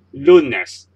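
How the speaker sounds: lunes with a firm first syllable LU, falling after; second-last syllable stressed. Shown as a demonstration. Teacher giving learners an example, lunes stressed on LU. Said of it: "stress will be on the second last syllable".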